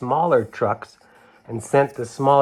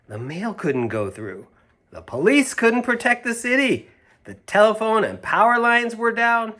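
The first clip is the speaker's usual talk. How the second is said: higher, rising dramatic read-aloud; dramatic story narration; 9 semitones higher, 4 dB louder than usual. A narrator's reading. Reader to children, high and dramatic. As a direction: build the disaster for the children.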